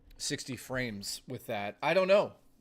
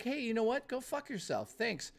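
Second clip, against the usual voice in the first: higher, sarcastically sweet mock voice; voicing the internet; 8 semitones higher, 3 dB quieter than usual. Streamer voices his internet telling him off, in a higher sarcastic voice. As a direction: play the internet as a smug little brat. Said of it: "My internet's just like".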